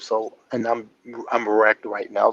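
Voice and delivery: ordinary talk, nothing marked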